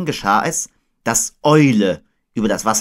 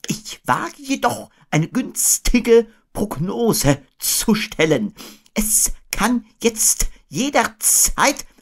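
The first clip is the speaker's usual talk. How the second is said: high, slow, ponderous owl; Eule; 13 semitones higher, slower than usual. A narrator's reading. Owl venturing a forecast, high and drawn out. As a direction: labour through the forecast high up.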